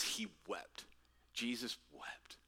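low, soft and slow; hushed, grave reading; solemn hush. A narrator's reading. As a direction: let it fall to a reverent near-whisper. Solemn quote of Jesus weeping.

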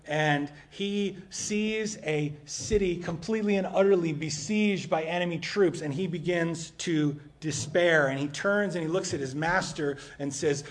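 loud, driving sermon delivery; preaching at full force. Acted. Pastor preaching loudly to the congregation about despair. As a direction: preach it out to the whole room.

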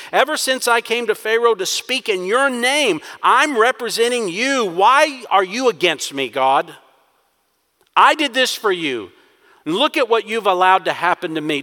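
selfish whine. Acted. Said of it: "It's almost as if he's saying".

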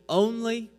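only lifted high and set apart; stress on only. Shown as a demonstration. Preacher drawing the congregation's attention, stressing only. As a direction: point out the word only. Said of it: "you'll notice the".

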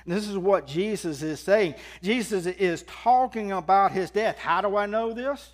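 loud, high proclaiming of scripture; preaching at full force. Acted. Proclaim every word. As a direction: pastor to congregation, reading scripture at full force.

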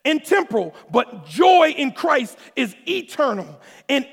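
high, loud preaching at full force; building sermon delivery, loud and high; fiery preaching. Acted. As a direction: preach it out to the congregation, hitting every word.

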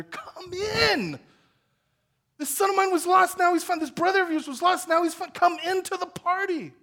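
loud, high, fervent plea; father pleading. Acted. Father begging his son to come in, loud and high. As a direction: call him in with all your heart.

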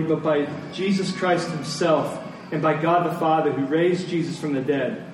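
usual speaking voice

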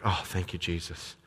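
a soft, hushed thank-you under her breath; said quietly. Retold or imitated. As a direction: murmur it privately so nobody at work hears. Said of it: "so she said quietly".

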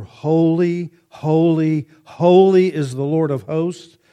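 loud ringing call of holy; loud, high, proclaiming holy holy holy. Retold or imitated. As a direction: cry it out like the angels. Angels crying out in Isaiah.

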